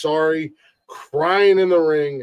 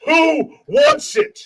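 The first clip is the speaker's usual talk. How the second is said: wrestling promo; 4 semitones higher than usual. Pretend. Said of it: "Let's hear it".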